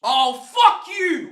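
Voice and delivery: slow, drawn-out high yell; outraged yell. Real, not acted. Streamer raging at Jackson coming on, slow high yell. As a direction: throw it at the screen in fury.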